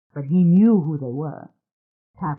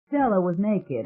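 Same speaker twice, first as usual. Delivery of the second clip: ordinary delivery, nothing marked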